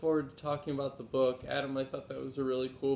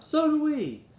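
high and loud, jumping in; eager exclamation; 14 semitones higher, 9 dB louder than usual. Acted. Benny jumps up to answer Grandfather, high and eager. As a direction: blurt it out like an excited little boy. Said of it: "Benny jumped up".

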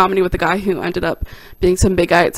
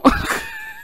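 1 high squeaky laugh. Real, not acted. Laughing at own story.

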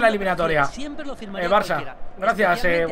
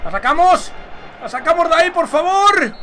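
higher-pitched urgent call to teammates; urgent pleading; 6 semitones higher than usual. Real, not acted. Player begs his team to clear the ball, urgent. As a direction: beg the defence to clear it, voice rising.

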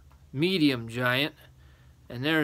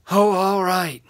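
loud, raised, exaggerated giving-in; sighing boy; 7 semitones higher, 10 dB louder than usual. A narrator's reading. Little boy giving in to the giants, exasperated. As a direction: give in with a big storybook sigh. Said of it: "sighed a big sigh".

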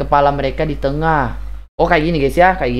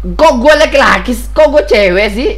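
high, loud outraged complaint; loud disbelieving gripe at the game; indignant protest; 10 semitones higher, 6 dB louder than usual. Real, not acted. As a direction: protest the mistake loudly. Indignant at female game character.

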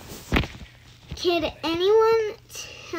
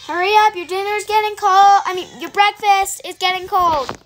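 calling out; 3 semitones higher, 8 dB louder than usual. Pretend. Pretend mom calling to kid.

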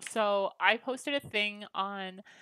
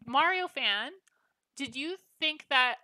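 ordinary talk

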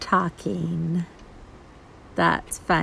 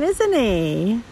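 ordinary delivery, nothing marked

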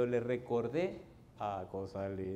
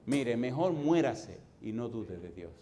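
ordinary delivery

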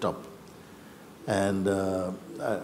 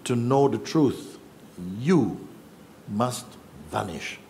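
solemn teaching; slower than usual. Retold or imitated. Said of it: "he said".

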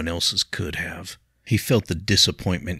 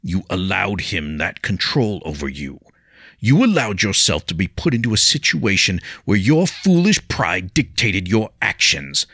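louder, firm lecturing rebuke; stern teacher Jiro; 3 semitones higher, 6 dB louder than usual. A narrator's reading. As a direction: lay down the law to him. Jiro scolds pupil.

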